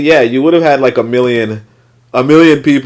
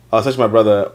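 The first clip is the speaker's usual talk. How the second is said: ordinary delivery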